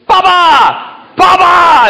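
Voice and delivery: put-on high shrill toddler shout; grandson yelling. Grandpa imitating grandson yelling from the crib. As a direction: yell for grandpa like a little kid. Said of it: "he started yelling".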